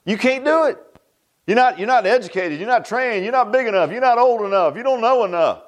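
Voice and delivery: high, sneering put-down voice; mocking naysayer. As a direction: mimic the doubters talking you down. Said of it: "you know what somebody's gonna say?".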